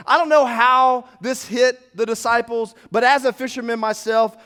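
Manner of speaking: normal talking voice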